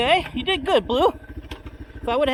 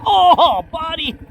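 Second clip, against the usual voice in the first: effort grunt; 5 semitones higher, 7 dB louder, much slower than usual. Real, not acted. Lifting bike over log.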